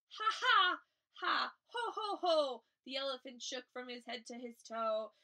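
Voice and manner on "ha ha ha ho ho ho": loud, high "ha ha, ho ho"; hammed-up storybook belly laugh; acted guffaw. A narrator's reading. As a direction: boom out each laugh syllable. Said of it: "He ho-hoed and ha-ha'd".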